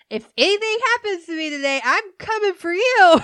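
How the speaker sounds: high, loud joking threat; mock customer threat. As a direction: warn playfully like a spooked customer. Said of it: "And she says".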